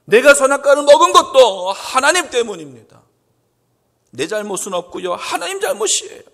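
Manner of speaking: loud it is God's fault; aggrieved high complaint; indignant blaming. Acted. Blame on God, acted.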